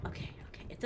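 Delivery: whispered okays; whispering. Real, not acted. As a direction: breathe it out in a whisper. Whispering to self.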